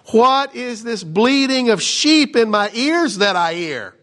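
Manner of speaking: a prophet scolding the king, drawing it out; indignant prophet. Acted. Pastor voicing Samuel scolding Saul, indignant.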